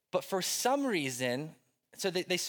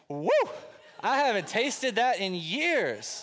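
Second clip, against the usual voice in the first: higher, louder and slowed, a hammed-up whoop of delight; mock delighted old lady; 5 semitones higher, 4 dB louder than usual. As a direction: play the churchgoer thrilled by her first sip in years. Elderly lady sips the cocktail.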